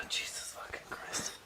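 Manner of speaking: sighing whisper under the breath; exasperated whisper. Real, not acted. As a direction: whisper it in disbelief. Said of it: "quit whispering".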